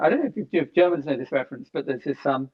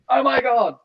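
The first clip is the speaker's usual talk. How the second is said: high, loud gushing amazement; amazed; 4 semitones higher, 3 dB louder than usual. Retold or imitated. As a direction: gasp it out like a wide-eyed convert. Mock amazement at video calls.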